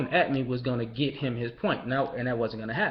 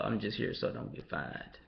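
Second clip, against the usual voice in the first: Marshawn Lynch deadpan; 6 semitones lower, 10 dB quieter than usual. Retold or imitated. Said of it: "we Marshawn Lynch".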